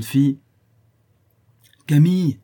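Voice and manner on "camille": high, loud call with falling tune; narrated shout of a name. A narrator's reading. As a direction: call for someone in another room. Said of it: "cria la bonne dame".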